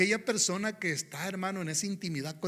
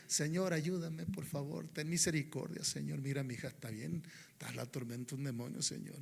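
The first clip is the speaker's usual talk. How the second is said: soft, hushed begging, much softer; quiet plea; 3 semitones lower, 10 dB quieter than usual. Shown as a demonstration. The Canaanite woman begging the Lord, hushed and timid. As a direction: murmur the plea timidly, the way not to pray.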